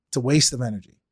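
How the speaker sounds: ordinary talk, nothing marked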